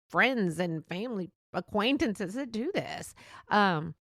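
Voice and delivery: usual speaking voice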